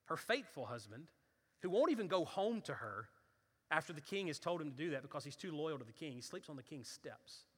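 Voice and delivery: ordinary delivery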